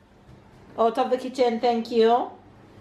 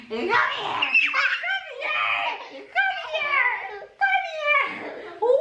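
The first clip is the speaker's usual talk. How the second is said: playful calling. Real, not acted.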